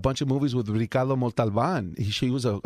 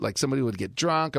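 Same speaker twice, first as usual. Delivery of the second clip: usual speaking voice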